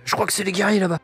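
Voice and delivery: dwarf voice. Acted. Infiltrator blaming the warriors to the dwarves, in a put-on dwarf voice. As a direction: pass yourself off as a tattling dwarf. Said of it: "prendre une voix de nain".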